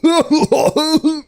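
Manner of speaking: high barked foreman laugh; barking laugh. Acted. Foreman reacts to the whistleblower, high barking laugh. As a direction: bark out a laugh in character. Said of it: "The foreman barks a laugh".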